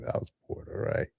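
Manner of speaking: whispered aside. Real, not acted. Whispering an aside to the viewers. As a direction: drop to a breathless whisper.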